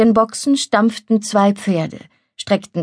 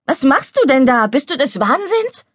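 higher-pitched, appalled scolding; outraged questions, pitched up; indignant; 6 semitones higher than usual. Acted. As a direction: scold him in disbelief. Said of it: "rief plötzlich die empörte Leni".

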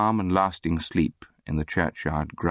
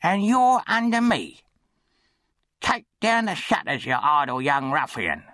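high-pitched bully's orders; bossy charity boy; 9 semitones higher than usual. A narrator's reading. Charity boy orders the orphan to work, high bossy voice.

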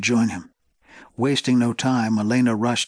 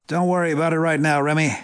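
brisk dad; 5 semitones higher, faster than usual. A narrator's reading. Father dismissing son.

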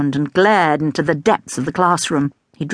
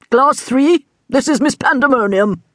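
headmaster voice, higher and formal; stiff headmaster; 7 semitones higher than usual. A narrator's reading. Headmaster presenting the new teacher to the class, formally. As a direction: announce her with stiff ceremony.

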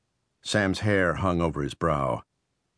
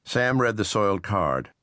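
usual speaking voice

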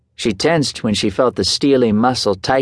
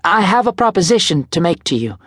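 light, higher woman's voice; young woman voice; 8 semitones higher than usual. A narrator's reading. Desiree voiced in dialogue.

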